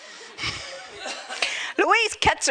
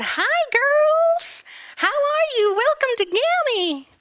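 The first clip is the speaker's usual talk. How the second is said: much higher, bright American greeting; American lady; 4 dB louder, slower than usual. Retold or imitated. Surgeon's wife greets the girls in a high American voice. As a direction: gush like an overly friendly American host. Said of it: "Something American anyway".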